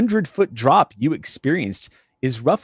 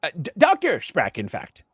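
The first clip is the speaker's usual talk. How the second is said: higher-pitched, pompous academic character; Doctor Sprack; 5 semitones higher than usual. Acted. Doctor correcting the visitor on his title, pompous character voice. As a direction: play a self-important doctor introducing himself.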